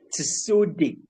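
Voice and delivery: Tisode said carefully, falling at the end; correct name pronunciation. Shown as a demonstration. Teacher showing students how a Nupe name goes, correct pronunciation. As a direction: model the name Tisode with a clear final "de". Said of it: "the right thing is".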